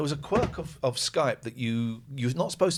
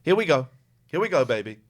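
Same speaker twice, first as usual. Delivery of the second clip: eager; 3 semitones higher, 8 dB louder, faster than usual. Real, not acted. Waiting for call to connect.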